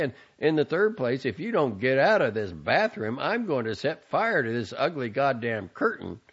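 loud, building tirade ending in a yell; angry son. A narrator's reading. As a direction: lose patience, shouting by the end. Son yells at mother.

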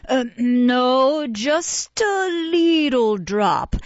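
slow, coaxing drawn-out plea; persuasive. A narrator's reading. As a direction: coax him gently, stretching each word. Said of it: "said Missus Mann persuasively".

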